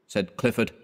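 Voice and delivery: usual speaking voice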